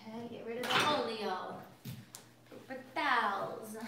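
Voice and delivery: a light hammed-up Italian accent on olio; playful touch of Italian accent; mock Italian accent. Shown as a demonstration. Cook joking to her viewers in a light Italian accent. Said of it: "Saying everything in an Italian accent".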